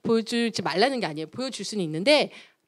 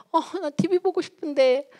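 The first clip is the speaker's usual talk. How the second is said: put-on whining child; 6 semitones higher than usual. Child pleading for TV.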